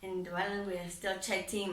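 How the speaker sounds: usual speaking voice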